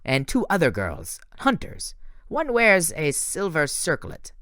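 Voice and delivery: put-on French-accented report on the hunters. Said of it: "weird French accent".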